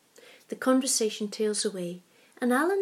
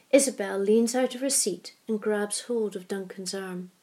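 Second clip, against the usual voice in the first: usual speaking voice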